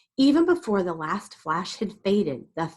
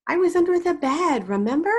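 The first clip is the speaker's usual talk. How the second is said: put-on child voice; 10 semitones higher, 5 dB louder than usual. Child voice in story.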